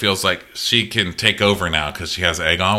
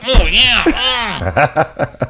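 much higher, louder creepy moan with a laugh; creepy pleasure; 11 semitones higher, 6 dB louder, much slower than usual. Retold or imitated. Larys relishing Alicent's toe show in a creepy moan. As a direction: relish it like a pervert.

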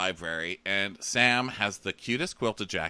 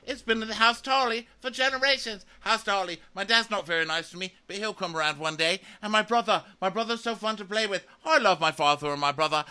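put-on much higher, fast childlike chatter; childish Sam voice; 11 semitones higher, 3 dB louder, faster than usual. Sam chattering naively to Daenerys about his family. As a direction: babble like an innocent boy. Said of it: "And he's like".

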